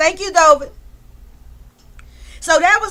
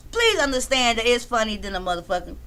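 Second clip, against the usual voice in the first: ordinary talk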